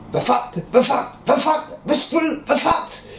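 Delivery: high, breathless whispered panic; panicked whisper. Retold or imitated. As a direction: gasp it out like doomed soldiers at the gate. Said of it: "And they're panicking".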